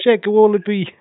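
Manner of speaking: high-pitched mock Australian; hammed-up Australian, high; Australian accent. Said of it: "we're doing the accents".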